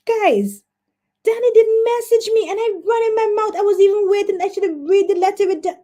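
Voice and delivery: high-pitched panicky confession; flustered dismay. Real, not acted. Host confesses to viewers she forgot Danny, high and flustered. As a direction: blurt out your blunder in a high voice. Panics at her own mistake.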